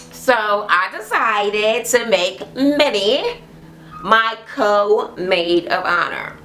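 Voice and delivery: ordinary talk, nothing marked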